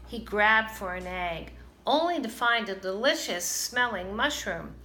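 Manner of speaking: usual speaking voice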